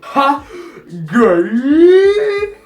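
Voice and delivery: yelling it across the room with a cackle; mocking shout. Schoolboy taunting a teacher, loud high jeering shout.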